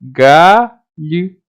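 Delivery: "Galho" drawn out as ga-lh-o; slow segmented "Galho"; sounds separated. Shown as a demonstration. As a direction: pause between the sounds of "Galho". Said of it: "é um som só que eu represento".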